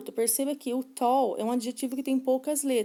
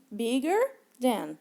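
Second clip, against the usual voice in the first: very slow, deliberate "bigger than"; slow model phrase; much slower than usual. Shown as a demonstration. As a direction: stretch out each word for the class. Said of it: "fica assim". ("bigger than" bem devagar, didático)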